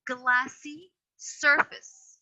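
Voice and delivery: "glassy surface." drawn out slowly, every syllable clear; slow emphasis. Shown as a demonstration. Teacher slowly stressing "glassy surface." to a student. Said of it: "You have to stop at a noun".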